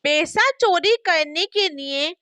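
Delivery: ordinary delivery, nothing marked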